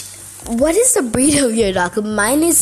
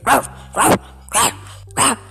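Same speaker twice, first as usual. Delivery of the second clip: imitated dog bark. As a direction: bark it out like an attention-seeking puppy. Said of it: "your dog will be like".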